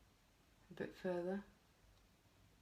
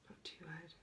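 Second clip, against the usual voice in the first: whispering; 15 dB quieter than usual. Real, not acted. Gentle instruction to child.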